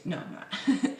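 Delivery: unvoiced whisper; whispered reply. Real, not acted. Vlogger answering himself in a whisper. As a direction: whisper it back to yourself.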